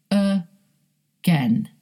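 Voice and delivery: "Again" split slowly as uh, GAIN; syllable-by-syllable schwa. Shown as a demonstration. Teacher isolating the schwa sound, split syllables. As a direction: break "Again" in two, starting on a schwa "uh". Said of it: "Uh is the schwa sound".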